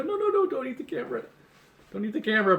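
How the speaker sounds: high, fast squeaky pleading at the cat; pet-scolding squeal. Real, not acted. Owner to kitty nibbling the camera, high squeaky no-no-no. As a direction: squeal at the kitten to stop chewing.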